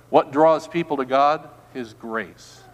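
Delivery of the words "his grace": very soft low His grace; almost whisper His grace; hushed. Real, not acted. Pastor softly naming grace.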